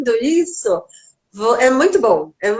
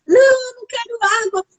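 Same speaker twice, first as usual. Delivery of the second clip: put-on higher, crying little girl's wail of refusal; crying child refusing; 11 semitones higher than usual. Mother retelling her daughter refusing water, crying out higher. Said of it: "Ela gritava".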